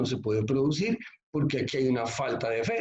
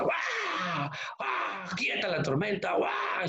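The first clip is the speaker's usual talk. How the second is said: hammed-up shouting prayer, barking high; mock rebuke; 5 semitones higher, 3 dB quieter, much slower than usual. Preacher parodies believers rebuking the storm, mock barking yells.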